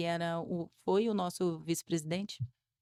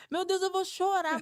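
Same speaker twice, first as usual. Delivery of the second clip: higher, louder, on the verge of tears; about to cry; 9 semitones higher, 3 dB louder than usual. Retold or imitated. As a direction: wail it like the tears are coming. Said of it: "Eu fiquei desesperada". (quase chorando, mais agudo e alto)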